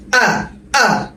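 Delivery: আ said softly from the throat start; glottal sound. Shown as a demonstration. Teacher showing how হামজা sounds, soft throat আ. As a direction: softly breathe out আ from the back of the throat. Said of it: "উচ্চারণটা কি হবে?".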